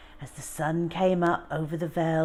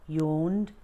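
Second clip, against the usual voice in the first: ordinary delivery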